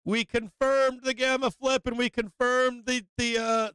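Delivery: riding the rush of a winning move; excited cheering. Real, not acted. Trader hyped on stream, shouting at his chart.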